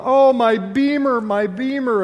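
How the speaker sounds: hammed-up sob for a lost Beamer; mock grief, drawn out; mock sobbing. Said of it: "and he's sobbing".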